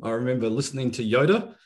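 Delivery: ordinary delivery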